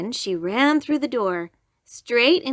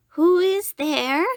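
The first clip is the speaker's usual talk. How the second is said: put-on old grandmother voice; 8 semitones higher, 5 dB louder, slower than usual.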